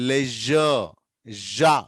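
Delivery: లెజర్ with a buzzed zh in the middle; careful zh demonstration. Shown as a demonstration. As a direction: linger on the zh of లెజర్, then జా alone as "zha". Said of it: "చాలా జాగ్రత్తగా పలకాలి".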